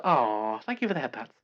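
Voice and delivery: usual speaking voice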